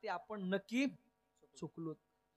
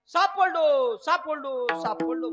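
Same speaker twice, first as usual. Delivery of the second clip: loud, higher cries of relief that fade over the repeats; joyful shout; 3 semitones higher, 14 dB louder, much slower than usual. Retold or imitated. Rambhau sees the thread and shouts with joy. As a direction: yell out in overjoyed relief. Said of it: "मोठ्यानं ओरडायला लागला, हसायला लागला".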